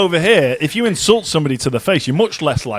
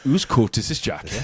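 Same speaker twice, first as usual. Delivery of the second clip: Welsh accent; 5 semitones lower, 6 dB quieter than usual. Shown as a demonstration. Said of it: "stereotypical phrases ... Welsh accent".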